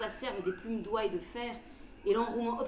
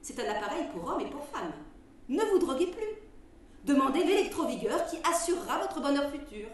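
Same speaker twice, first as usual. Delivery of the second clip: ad read; 2 semitones higher, 5 dB louder than usual. Acted. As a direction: sell it like an old radio ad. Reads a quack remedy advertisement.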